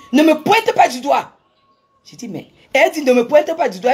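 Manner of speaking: high, loud indignant command; angry order. Retold or imitated. The woman telling the speaker not to point, loud indignant order. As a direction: snap at her not to point, outraged.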